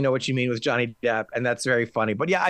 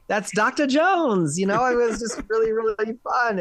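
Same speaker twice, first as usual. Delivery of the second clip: excited; 8 semitones higher than usual. Retold or imitated. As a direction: imitate someone thrilled, pitched high and stretched. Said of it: "was so excited".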